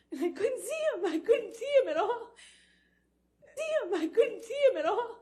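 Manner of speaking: very high, loud, sobbing voice; overcome, words pushed out high through sobs; real crying. Real, not acted. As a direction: wail it through tears. Breaking down while reliving it.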